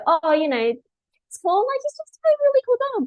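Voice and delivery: high, quick, gushing owner voice; gushing dog owner. Retold or imitated. Imitating a client who gushes about her dog, high and quick.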